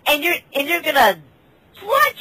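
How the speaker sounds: high, disbelieving mock outrage; incredulous. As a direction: sound stunned he'd drop the daggers. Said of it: "And I'm like, I'm like".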